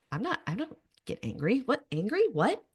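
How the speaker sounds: fast, flustered protest, imitating herself; mock defensive denial. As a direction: brush it off too quickly, hammed-up. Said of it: "The defensiveness went into full force".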